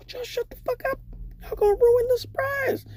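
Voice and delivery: high, soft strained shush; high hushing voice. Retold or imitated. Stripper hushing giggling nurses, high strained shush. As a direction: pitch it way up and hold it down.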